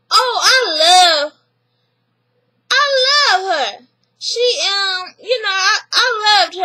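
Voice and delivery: high, slow, tearful-sounding gush; gushing sister. Retold or imitated. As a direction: swoon over her, high and drawn out.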